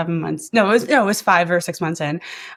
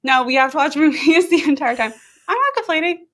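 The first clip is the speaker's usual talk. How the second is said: laughing; 9 semitones higher than usual. Real, not acted. Mock complaint about movie flights.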